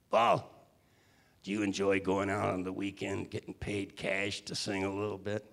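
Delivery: a hint of a South Side Chicago boss leaning in; Tony Owen voice. Tony the label man quizzing young Paul, a lightly voiced Chicago heavy. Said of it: "he talked like this".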